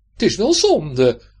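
loud, slow, sighing regret; mother's regret. Acted. Hendrik's mother sets the shoes out, slow and regretful. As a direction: linger over the waste.

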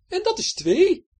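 high, slow, smug count; Betje counting. Acted. Betje at the door counting, pleased. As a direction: savour the number. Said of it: "zei Betje vanaf de deur".

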